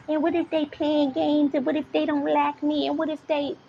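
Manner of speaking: mocking a worrier spiraling; mock worried voice. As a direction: imitate an anxious overthinker. Mocking anxious self-talk.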